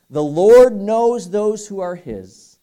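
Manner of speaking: slow, loud and high, each word weighted; emphatic scripture quote. Real, not acted. Preacher proclaiming Timothy to the church, slow and loud. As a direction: proclaim it slowly like a verdict.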